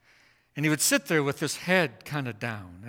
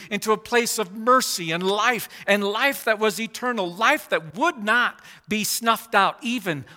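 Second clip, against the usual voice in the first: preaching climax; 7 semitones higher, 6 dB louder than usual. Real, not acted. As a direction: proclaim it like the heart of the sermon. Sermon climax.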